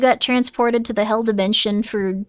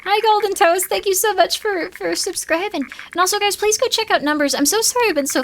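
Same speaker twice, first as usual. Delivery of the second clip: bright thank-you; 9 semitones higher than usual. Real, not acted. Chirpy greeting to subscriber.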